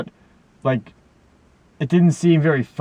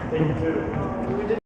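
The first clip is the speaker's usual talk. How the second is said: dramatic trailer voice; 5 semitones higher, 6 dB quieter, much slower than usual. Pretend. Reads poster title dramatically.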